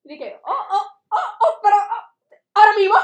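high, loud squeals through laughter; shrieking laughing glee; giddy laughing. Retold or imitated. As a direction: lose it with delight. Thrilled reaction, laughing.

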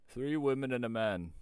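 slow, loud, grave pronouncement; old shepherd's solemn warning. A narrator's reading. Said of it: "said the shepherd gravely".

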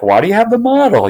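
put-on high, curious child asking; curious kid voice. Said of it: "Sam and my daughter are like".

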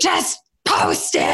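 high, slow, drawn-out mocking demand; mock a demanding roommate in a high, stretched voice; impatient mimicry. Acts out partner's impatient demand.

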